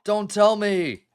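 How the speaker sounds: loud, slow, dread-filled cry; bracing for the worst out loud; dreading shout. Real, not acted. Gamer watching her own death replay, loud and dreading.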